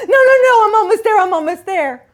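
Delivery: high, loud panicky protest; frantic pleading. Retold or imitated. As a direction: beg him to wait, rising in panic. Ex frantically pleading over text.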